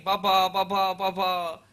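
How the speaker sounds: loud, high calls of Papa; a child shouting for his dad as the door opens; retold shout. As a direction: call out for your father, loud and high. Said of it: "he's shouting".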